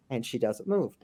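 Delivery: normal talking voice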